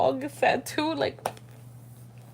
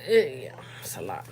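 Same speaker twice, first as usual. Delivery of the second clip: low breathy whisper, much softer; 9 semitones lower, 4 dB quieter than usual. Real, not acted. Worn-out mom whispering aside to her viewers.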